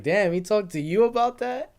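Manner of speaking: high, loud, amused disbelief; queen teasing her husband; surprised queen. Retold or imitated. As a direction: act stunned and amused. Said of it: "she was like".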